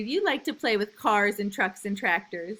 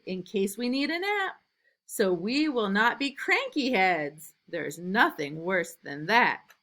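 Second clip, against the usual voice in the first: lively, louder storybook reading; reading to children; 2 semitones higher, 5 dB louder than usual. A narrator's reading. Teacher reading a picture book to new kindergartners, lively and loud. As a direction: read it big and bouncy for little kids.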